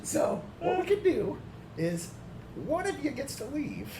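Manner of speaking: high, sly crone voice; evil old lady voice. Acted. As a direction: offer the deal like a scheming witch. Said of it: "She's like".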